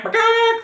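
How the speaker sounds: very high, loud hen squawk; hen cluck. A narrator's reading. Animals attack the farmer.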